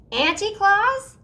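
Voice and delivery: high, slow, loud astonished call; girl spotting her aunt on stage, surprised shout. A narrator's reading. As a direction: shout her name in disbelief. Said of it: "Sophie shouted".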